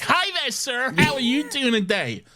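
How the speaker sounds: high, chirpy mock greeter voice; put-on American customer-service cheer; overeager store greeter.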